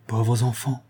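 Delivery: a touch of weary dismay; understated, weighed-down pity; overwhelmed, dejected. A narrator's reading. Father pitying his sleepless children, dejected. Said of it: "dit Morel avec accablement".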